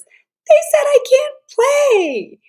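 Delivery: put-on high, loud, slow wail of a hurt little girl; upset child's cry. Girl left out of the game, crying it out high and loud. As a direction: wail it like a kid left out on the playground. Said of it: "And the feeling is ... anger".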